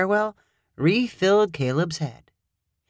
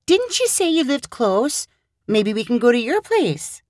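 higher, lightly voiced young woman, friendly; Hannah's voice; 6 semitones higher than usual. Hannah smiling, suggesting they go to Caleb's place. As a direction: invite him over with a smile.

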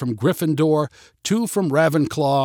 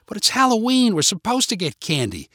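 put-on high-pitched child's protest; arguing kid; 8 semitones higher than usual. A narrator's reading. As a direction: plead the case starting way up high. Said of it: "argued the kid".

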